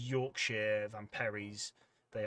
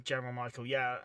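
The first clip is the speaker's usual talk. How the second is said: normal talking voice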